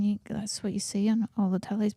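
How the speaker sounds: normal talking voice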